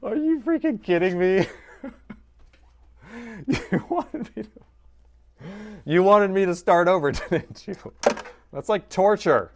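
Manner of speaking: high, loud laughing protest; mock outrage. Real, not acted. Streamer accusing chat in high laughing outrage.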